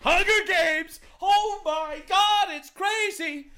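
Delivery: high, loud mock excitement; hammed-up gleeful shouting; hyped-up shout. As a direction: yell it like an overexcited show host, stretching it out. Jokingly cheers his game show idea.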